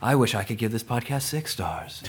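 slow, soft seductive ad voice; sultry perfume ad. Pretend. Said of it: "my sultry voice".

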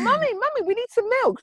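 high-pitched imitation of a child; pleading child. Mother mimicking her child asking for milk, pleading. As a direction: pipe up like a small child pestering mum.

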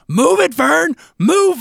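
high-pitched yell imitating a kid at the TV; imitated screaming. Dad imitating his son screaming at a movie character, high urgent yell. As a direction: yell it high, urging someone on. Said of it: "My son was screaming at Vern".

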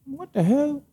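high-pitched, baffled aside; retold bewilderment. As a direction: wonder it to yourself, voice shooting up. Retold puzzlement at their absence.

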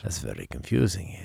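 put-on quiet, muttered Russian accent. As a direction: puzzle over it softly as a mock Russian. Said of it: "Russian [name]".

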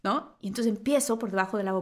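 normal talking voice

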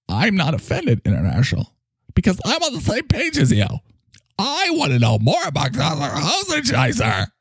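swooping, theatrical character voice; effusive sidekick. Acted. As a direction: gush with exaggerated sincerity. Plays effusive Mike.

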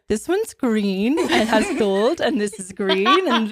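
slow, lightly mocking imitation of a rambly makeup review, laughing; imitating friend's old video. Teasing friend about her first eyeshadow video, slow mock imitation with a laugh. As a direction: tease her by replaying her first video, slowly. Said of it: "You were like, I was like".

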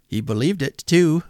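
put-on high, amused young man; merry nephew. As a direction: toss it off still laughing. Nephew's voice continues.

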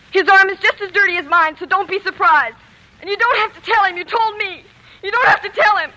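loud, hurt defiance; indignant outburst. Acted. Girl scolding the doctor for shaming her, loud indignant protest. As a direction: stand up for your brother, loudly.

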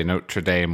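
"Notre Dame" with an r, NOH-truh DAYM; American "Notre Dame", Dame rhyming with name; American pronunciation. Shown as a demonstration. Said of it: "in America you say".

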